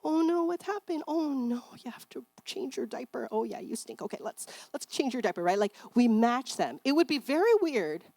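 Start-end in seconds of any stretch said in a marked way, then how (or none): 0.0-5.4 put-on high, sing-song comfort for a baby; soothing baby talk. Shown as a demonstration. Mother comforting her crying baby with high baby talk. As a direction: coo to a crying baby as you change it. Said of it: "we let the baby know".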